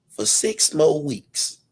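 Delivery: slow, rising plea; slowed for emphasis. Acted. Pleading with the streamer to keep it up, slow and rising. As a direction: stretch each word like a deal offer. Asking a favor, dragging words.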